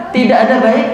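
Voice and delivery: ordinary talk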